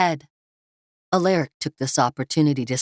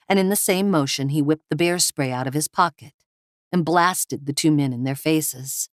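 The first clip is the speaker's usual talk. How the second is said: plain reading voice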